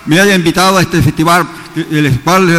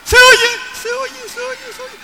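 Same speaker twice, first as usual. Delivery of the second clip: echo voice. Shown as a demonstration. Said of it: "El eco de una montaña".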